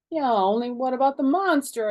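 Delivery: high, loud teary plea; on the edge of tears; a teeny bit teary. Acted. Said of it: "started to cry a teeny bit".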